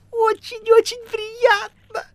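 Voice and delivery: very high, drawn-out cat squeal; squeaky voice. A narrator's reading. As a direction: squeak it slowly and loudly. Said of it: "пескливым голосом отозвался". (писклявый кошачий голос)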